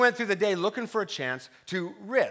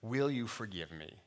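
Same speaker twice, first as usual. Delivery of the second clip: contrite apology; 7 semitones lower, 8 dB quieter than usual. Retold or imitated. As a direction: humbly ask forgiveness. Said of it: "and I said".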